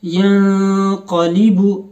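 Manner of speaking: यंक़लिबु slow, न hidden in the nose; slow nasal Quran recitation; nasal ikhfa. Shown as a demonstration. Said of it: "नाक में आवाज़ छुपा कर पढ़ रहा हूँ".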